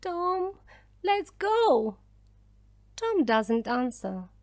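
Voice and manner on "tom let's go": high-pitched, anxious plea; anxious urging. Acted. Milly wants Tom to leave, anxious urging. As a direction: urge him away in a high voice. Frightened girl urges escape.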